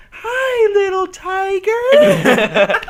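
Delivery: high, slow sing-song coo at a cute tiger; mock cutesy baby talk. Cooing at a tiger, mock example.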